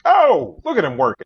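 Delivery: high, loud playful outburst; loud mock exclamation. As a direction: burst out high and loud, playacting. Mock reaction to Batman writer.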